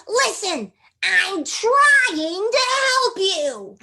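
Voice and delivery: spunky tomboy puppet, high and loud; Ginger. Acted. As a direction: draw it out in a loud, high, feisty character voice. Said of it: "Ginger's, like, crazy spunk, like, tomboy".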